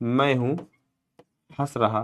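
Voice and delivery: slow, quiet, one word at a time; quiet, slow word-for-word breakdown. Shown as a demonstration. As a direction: spell out the Hindi pieces slowly and softly, word by word. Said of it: "क्या हो जाएगा".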